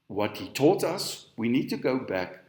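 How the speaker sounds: normal talking voice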